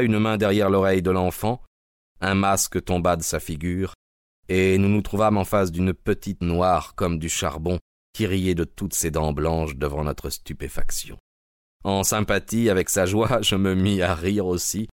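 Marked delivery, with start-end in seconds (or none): none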